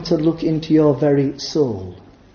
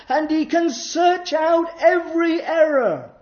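high, loud pulpit climax; fiery preaching; 14 semitones higher, 4 dB louder than usual. Acted. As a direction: preach it out with full force. Preaching at full force.

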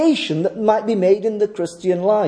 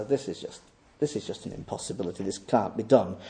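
much softer and lower, a defeated confession; quiet resigned admission; 13 semitones lower, 11 dB quieter, faster than usual. Retold or imitated. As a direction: let it sink to a hushed, lower voice. Honest person admits impossibility.

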